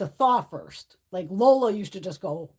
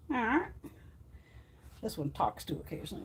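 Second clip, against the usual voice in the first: normal talking voice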